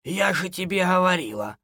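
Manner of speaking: put-on high old woman's wail, mild; lamenting. A narrator's reading. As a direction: reproach in a high granny voice. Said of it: "запричитала хозяйка". (бабушка причитает, высоко)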